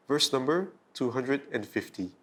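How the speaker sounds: ordinary delivery, nothing marked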